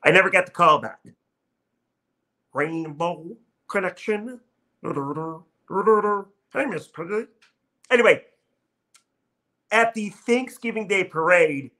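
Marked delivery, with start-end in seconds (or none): 2.5-7.3 frog puppet voice humming a tune; Kermit the Frog. Retold or imitated. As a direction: croon it like the frog puppet, then greet the pig. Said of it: "I got a pretty good Kermit impression".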